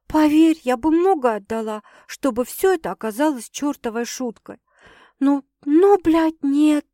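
earnest, loud on the outburst; meaningful, emphatic. A narrator's reading. Girl assuring sister it's no joke, emphatic. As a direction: insist hard that it is no joke. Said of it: "со значением произнесла девушка".

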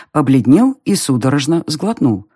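ordinary delivery, nothing marked

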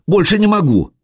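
high breathless plea; beg, out of strength; pleading. Acted. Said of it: "взмолилась Буданка".

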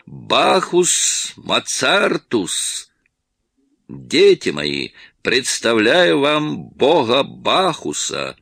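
slow, high, pompous tipsy announcement; mock grand introduction. Tipsy Носков introduces the actor to friends, grandly. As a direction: present him like a god at a feast. Drunk man presenting the actor.

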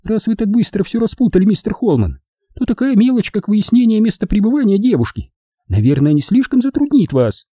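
high falsetto sarcasm; high-pitched mock politeness; scornful snort. A narrator's reading. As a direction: needle him in a high woman's voice. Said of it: "фыркнула Эдвина".

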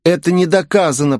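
a touch of indignant hissing; mild hiss. A narrator's reading. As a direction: protest through a half-hiss. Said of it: "прошипел мокрый студент".

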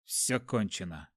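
low, soft and despairing; glum. A narrator's reading. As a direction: say it like all hope is gone. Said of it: "уныло подумал я".

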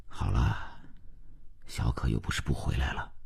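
soft, low, tender reassurance; gentle soothing. A narrator's reading. Husband comforts his crying wife in the kitchen, gentle soothing. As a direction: comfort quietly, voice dropped. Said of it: "温言细语".

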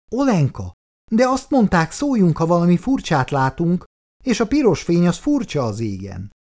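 lightly voiced child insisting on the rule; little girl's voice. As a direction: explain it like a serious little girl. Little girl explains to carer.

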